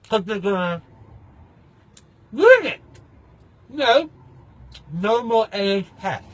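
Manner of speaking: "ruin it" bursts out high and loud; sudden shout. Real, not acted. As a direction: yell out "ruin it". Outburst about the NHS.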